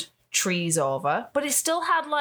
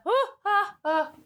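high moaning oh ah; orgasmic moan; 11 semitones higher than usual. Shown as a demonstration. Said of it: "It just requires a".

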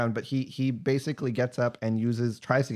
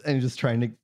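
usual speaking voice

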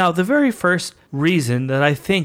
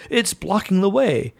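raised voice; 7 semitones higher than usual. Retold or imitated. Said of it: "Why did you raise your voice?".

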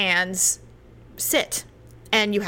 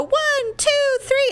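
high-pitched count leading a chant; mimed crowd countdown; much slower than usual. Retold or imitated. Acting out a fan's countdown.

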